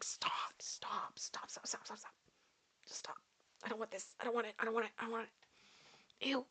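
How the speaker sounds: hushed, repeated plea to make it stop; whispered pleading. Retold or imitated. Reacting to nail sounds in ASMR, whispered recoil. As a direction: act out squirming in a half-whisper.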